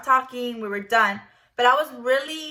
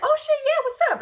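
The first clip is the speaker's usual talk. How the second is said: eager yes; 3 dB louder, faster than usual. Retold or imitated. Retold eager yes to invite.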